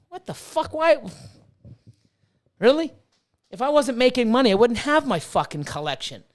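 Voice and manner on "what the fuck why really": incredulous outburst. Real, not acted. Reacting to critics who call him bad, in high disbelief. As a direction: react like you can't believe it.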